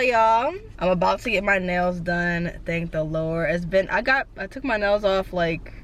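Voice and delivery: ordinary talk, nothing marked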